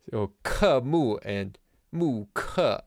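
slow "ke mu and mu ke" with a falling fourth-tone "ke"; teacher slowly modeling "ke mu" and "mu ke" with fourth-tone "ke". Shown as a demonstration. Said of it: "It is fourth tone".

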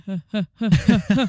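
creepy, spaced-out "huh"s; mock a creepy lazy chuckle; imitated lazy laugh. Telling friend his new laugh is creepy, mocking imitation. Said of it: "It's creepy".

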